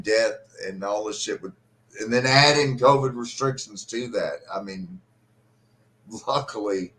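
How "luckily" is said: ordinary talk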